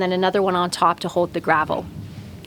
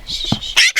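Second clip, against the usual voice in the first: shushing. Real, not acted. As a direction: hush it gently. Hushing the fledgling.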